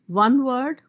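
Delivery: ordinary talk, nothing marked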